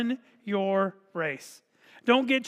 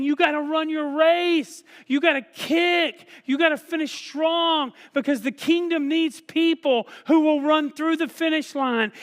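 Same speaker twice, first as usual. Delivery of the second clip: high, loud shouted urging; rally the runner to finish strong; urgent exhortation; 6 semitones higher, 4 dB louder than usual. Retold or imitated. Heavenly witnesses urging believers to finish, shouting. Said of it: "who are saying".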